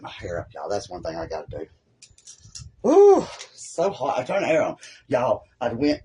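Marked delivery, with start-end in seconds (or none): 2.8-3.3 high, drawn-out "woo"; loud whoop at the heat. Real, not acted. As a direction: let out a long, high woo. Reacting to being hot.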